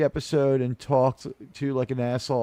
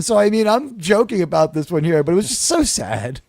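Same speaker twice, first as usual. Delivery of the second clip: ordinary talk, nothing marked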